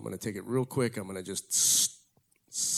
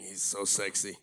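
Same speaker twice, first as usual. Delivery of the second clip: normal talking voice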